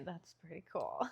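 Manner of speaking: whispered. Real, not acted. Quiet aside after approving.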